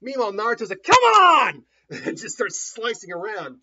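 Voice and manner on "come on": high, very loud goading shout; full-throated acted war cry; battle yell. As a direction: roar a challenge at the enemy. Acting Naruto's battle cry.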